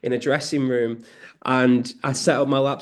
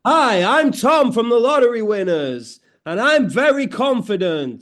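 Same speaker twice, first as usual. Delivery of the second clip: higher, louder, over-confident announcer; mock radio voice; 8 semitones higher, 5 dB louder than usual. Guest trying out the host's confident radio voice, playful mock announcer. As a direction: put on a big confident radio-presenter voice. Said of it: "That's your radio voice".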